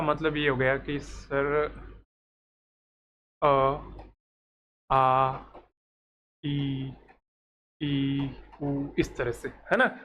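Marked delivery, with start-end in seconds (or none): 3.4-8.9 अ, आ, ई, उ drawn out one by one, slowly; vowel sounds demo. Shown as a demonstration. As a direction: say each vowel sound separately with pauses. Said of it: "जिनको स्वर कहते हैं".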